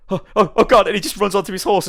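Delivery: high, loud stammering alarm; hammed-up frightened outburst; mock panic. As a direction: play a caught trickster panicking. Merchant panics, runs away.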